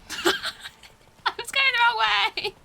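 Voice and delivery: high, drawn-out cry through laughter; helpless real laughter in the words; laughing. Real, not acted. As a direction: crack up while saying it, pitched high and slow. Laughs at own wrong turn.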